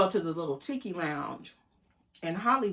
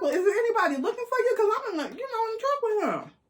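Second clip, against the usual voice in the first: much higher, fast and nervous; high panicky questioning; alarmed; 3 dB louder than usual. Retold or imitated. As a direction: rush it out in mock panic. Her alarmed question about pursuers.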